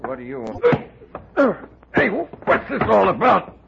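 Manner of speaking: slow, high, rising to a panicked shout; a man seized in the dark, scared; frightened protest. Acted. Man grabbed and roughed up.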